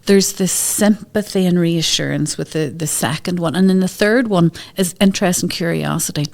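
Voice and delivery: usual speaking voice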